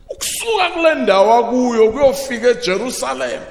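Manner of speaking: ordinary delivery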